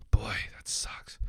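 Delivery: soft, slow, unvoiced disappointed whisper; whispered aside. Real, not acted. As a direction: breathe it out under your breath. Sudden sad thought, mutters aside.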